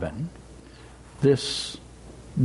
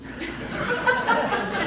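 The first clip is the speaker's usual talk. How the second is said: high breathy laugh; amused laugh at Owl's advice; delighted laugh; 4 dB quieter than usual. Real, not acted. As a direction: laugh fondly at Owl. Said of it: "I love Owl".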